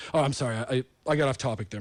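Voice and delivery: ordinary delivery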